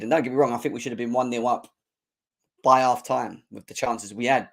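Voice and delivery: ordinary talk, nothing marked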